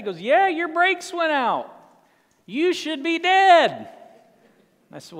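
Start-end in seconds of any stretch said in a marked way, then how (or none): 0.2-3.9 higher, louder and slow country voice; slow high blunt country driver; tow truck driver Bubba. Retold or imitated. Bubba tells him brakes failed.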